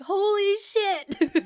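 high, slow, laughing holy shit; stunned fan laughing out loud; shocked laughing exclaim. Retold or imitated. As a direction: shriek it through laughter. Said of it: "I was like".

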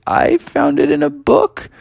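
very high, squeaky nervous answer; acted meek little voice; timid. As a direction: pitch it way up, ending on a rise. Timid reply to frightening voice.

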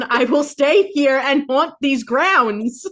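high, slow, loud haunting threat; ghost voice. Acted. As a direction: loom over them like a gleeful ghost.

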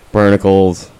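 normal talking voice

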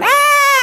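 sudden very high "Ah" shout; wild worship cry. Retold or imitated. Preacher mimics worshippers crying out, high ecstatic shout. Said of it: "people going".

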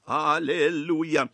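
usual speaking voice

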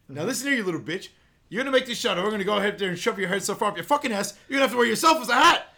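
fast, high, loud snarling rant; hammed-up bully threatening him; mock furious threat. Teammate pinning him to the wall, fast mock furious threat.

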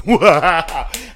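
loud, high retold shock with laughter; incredulous outburst. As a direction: burst out in disbelief. Retold disbelief at the refusal.

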